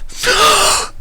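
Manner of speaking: high, sudden offended gasp; sharp gasp. A narrator's reading. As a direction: gasp as if hurt. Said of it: "let out a sharp gasp".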